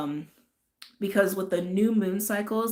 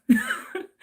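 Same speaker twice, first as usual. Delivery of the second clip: high laugh; 14 semitones higher, 4 dB louder than usual. Real, not acted. Laughs at own intense words.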